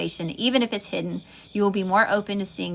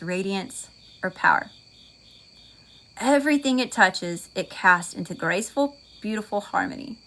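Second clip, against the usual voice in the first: measured visualization with long pauses between phrases; deliberate, spaced-out guiding voice; guided meditation; 3 dB louder than usual. Real, not acted. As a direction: lead them through a healing meditation, phrase by phrase. Leads a guided healing visualization.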